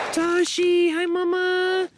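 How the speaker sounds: very high cooing call; high pet greeting. Real, not acted. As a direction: call out sweetly to a beloved animal, way up high. Greeting her yaks like pets.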